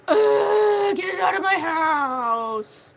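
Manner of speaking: high, loud, slow mock shout of disgust; brother's disgusted yell. Brother ordering the yarn out, loud mock disgust. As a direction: whine it out like a fed-up brother. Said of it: "And he's just like".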